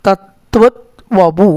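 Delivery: തത്വത് വപു read slowly, syllable by syllable; slow split reading. Shown as a demonstration. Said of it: "ത്വത് plus വപു ആണ്". (തത്വത് വപു പതുക്കെ ചൊല്ലുക)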